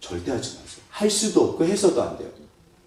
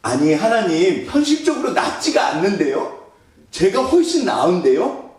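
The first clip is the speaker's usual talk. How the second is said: indignant protest; 6 semitones higher, 3 dB louder than usual. Acted. Acted proud protest to God.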